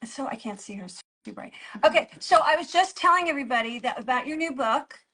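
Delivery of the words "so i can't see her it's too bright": whispered aside. Real, not acted. Aside about the camera.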